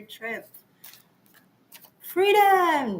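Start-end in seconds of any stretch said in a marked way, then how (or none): 2.0-3.0 high, drawn-out cry of liberation; mock triumphant cry. Joking about her post-military trip, mock triumphant cry. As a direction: cry out "Freedom!" in mock triumph, pitch falling away.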